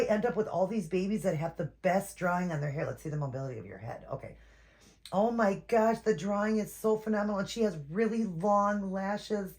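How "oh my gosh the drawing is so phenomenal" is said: ordinary delivery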